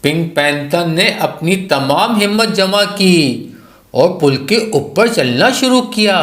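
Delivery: plain reading voice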